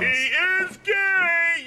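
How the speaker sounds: mock high-voiced remark; high excited voice. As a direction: pitch way up and draw it out. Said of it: "my vocal cords go up, like, several octaves".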